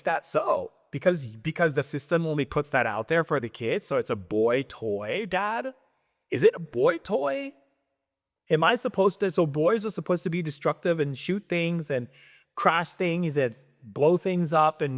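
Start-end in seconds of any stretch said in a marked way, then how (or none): 5.3-10.2 put-on much higher, innocent little-kid voice; mock a small boy's plea, pitched up; child asking dad. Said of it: "And I was like".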